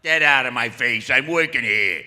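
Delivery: loud gruff Brooklyn shove-off; tough Brooklyn plumber. Retold or imitated. Plumber at the audition yelling to be left alone. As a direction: bark it like a tough Italian plumber. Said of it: "Italian plumber from Brooklyn, you know".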